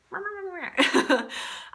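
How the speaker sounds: high mumbled mimicry of a nagging voice; nagging little inner voice. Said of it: "That's my little voice".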